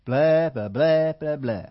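droning nonsense syllables; mock blah blah. Preacher mimicking how faith words sound, droning blah. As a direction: mimic meaningless chatter. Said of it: "All we hear is".

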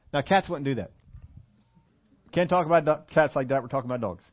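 ordinary talk, nothing marked

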